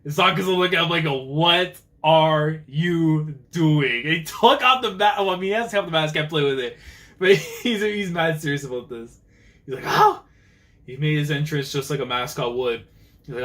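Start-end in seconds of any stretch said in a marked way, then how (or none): 1.1-4.0 very slow, word by word; drawn-out, incredulous question; acted disbelief. Acts out staring at a teammate. 9.9-10.2 sudden high, loud yelp; short startled cry; acted "oh" yelp. Acts out a character's outburst.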